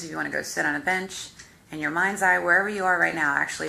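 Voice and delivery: normal talking voice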